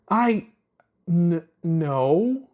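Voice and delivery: high, flustered stammer. A narrator's reading. Chuck caught out by Ponder's question, stammering high. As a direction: trip over the no. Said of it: "Chuck stammered".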